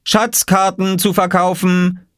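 high, drawn-out vendor's cry; calling out. A narrator's reading. Oskar hawks his treasure maps, calling out. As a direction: call it out slowly like a market seller. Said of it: "rief Oskar".

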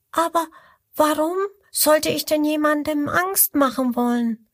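high, puzzled little croc voice; little crocodile asking. Acted. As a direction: ask innocently in a tiny high voice. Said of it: "fragt das kleine Krokodil".

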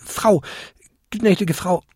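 high-voiced, flustered little kobold; apologise, groping for the right title; halting, high-pitched apology; stammering apology. A narrator's reading. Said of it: "stammelte der kleinste Winterkobold".

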